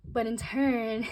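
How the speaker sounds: normal talking voice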